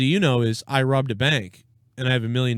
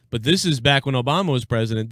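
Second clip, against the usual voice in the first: normal talking voice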